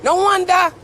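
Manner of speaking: usual speaking voice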